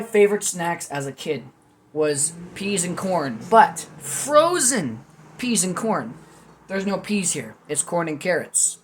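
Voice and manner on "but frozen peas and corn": nostalgic emphasis. Real, not acted. Fond memory of childhood snack.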